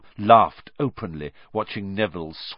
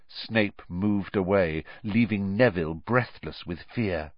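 plain reading voice

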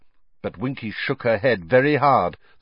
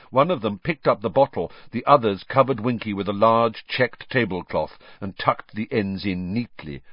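ordinary delivery, nothing marked

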